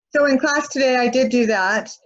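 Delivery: normal talking voice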